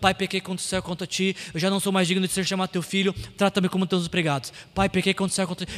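quick, low, soft recitation; memorizing a speech. Acted. Son walking home rehearsing his confession, quick muttered recitation. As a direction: rattle it off under your breath. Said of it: "e decorando".

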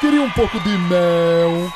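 slow, drawn-out cartoon bear voice; Winnie the Pooh voice. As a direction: ask for honey like a sleepy cartoon bear. Imitando o Ursinho Puff de novo. (voz de ursinho de desenho, lenta)

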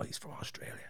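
hushed whisper of gossip. Retold or imitated. Locals at the Irish pub.

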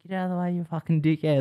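high, joking yell across cars; playful high-pitched insult across the cars; laughing yell. Retold or imitated. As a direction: yell it at a mate, half laughing. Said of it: "Like, laughing about it".

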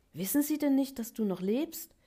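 high, loud excited call; aufgeregter Ruf. A narrator's reading. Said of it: "Rief Happy". (aufgeregt rufen, hoch und laut)

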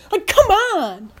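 high, drawn-out cry of frustration; exasperated protest. Real, not acted. Fan protesting a missed holding call, exasperated. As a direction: stretch it out, indignant.

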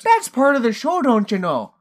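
folksy mock-Minnesotan cop, starting high; Minnesotan accent. Cop explaining the show to ma'am, thick Minnesotan accent. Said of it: "who has the Minnesotan accent".

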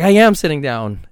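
loud, indignant retort; aggravated. Retold or imitated. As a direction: snap back loud, insisting you're sitting.

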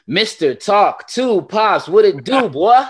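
high, loud and drawn-out hype call; hype guest intro. Acted. Podcast host bringing in his special guest, big hype shout. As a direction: announce him like a big-name arrival.

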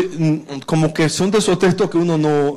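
ordinary talk

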